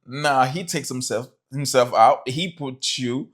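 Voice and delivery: usual speaking voice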